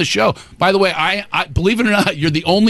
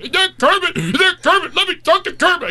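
put-on high, excited little kid asking for Kermit; a small boy begging to talk to Kermit; 11 semitones higher than usual. Kid shouts to mom on the phone, high and eager. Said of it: "the kid going".

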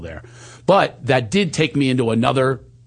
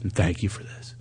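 tearful whisper; 4 semitones lower, 7 dB quieter than usual. Retold or imitated. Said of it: "she's crying".

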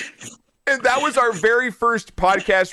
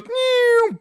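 high drawn-out "neeow" noise; imitated swoosh. Storyteller mimics dad toppling onto table, high swooshing noise. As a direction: mouth a high cartoon plummet.

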